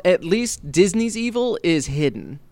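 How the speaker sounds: normal talking voice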